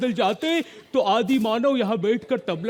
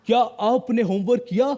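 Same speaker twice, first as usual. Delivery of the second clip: ordinary talk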